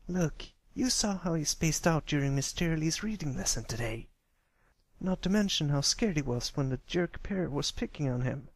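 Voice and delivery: a doubtful little pony arguing her case; young filly voice. A narrator's reading. Narrator voices filly Scootaloo.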